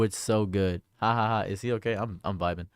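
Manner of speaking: ordinary talk, nothing marked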